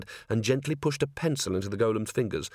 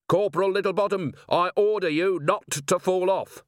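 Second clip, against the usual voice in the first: higher-pitched, emphatic command; called-out order; 8 semitones higher than usual. A narrator's reading. Vimes commanding the dangling corporal, urgent raised voice.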